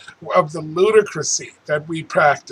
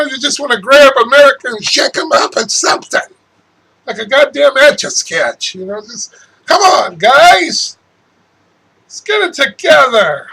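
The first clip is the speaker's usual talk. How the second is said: high and loud, pleading; loud, high urging to get it together; agitated plea; 6 semitones higher, 5 dB louder than usual. Real, not acted. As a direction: urge them on, fast then insistent.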